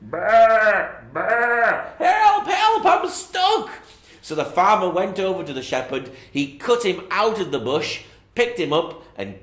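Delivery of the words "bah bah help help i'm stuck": loud, very high bleating shout for help; sheep shouting. Acted. Sheep spots shepherd, loud high bleating shout for help. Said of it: "he shouted out".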